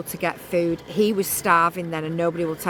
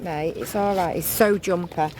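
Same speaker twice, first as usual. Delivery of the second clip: usual speaking voice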